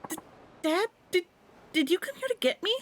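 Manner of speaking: put-on high, small child's voice; a relieved kid asking her dad.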